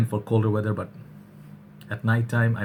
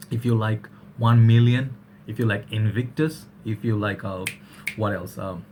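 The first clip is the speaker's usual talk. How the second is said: normal talking voice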